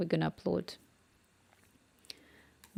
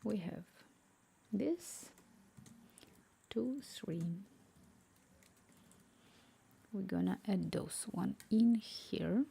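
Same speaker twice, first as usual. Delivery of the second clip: whisper; 4 dB quieter, slower than usual. Real, not acted. Whispering while working.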